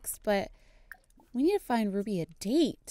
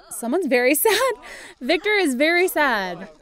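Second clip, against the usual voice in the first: amused; 4 semitones higher, 6 dB louder than usual. Real, not acted. Laughing at the sad character.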